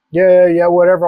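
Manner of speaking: bored, dismissive yeah yeah; dismissive brush-off. Retold or imitated. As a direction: wave it off like it's nothing. Family brushing off his warning.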